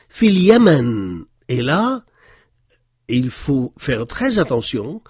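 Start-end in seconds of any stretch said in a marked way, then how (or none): 0.1-1.3 Fi Yemen read slowly and louder, as one phrase; slow, louder model reading. Shown as a demonstration. Teacher models the Arabic phrase for learners, slow and loud. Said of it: "ne pas faire de pause entre les deux mots".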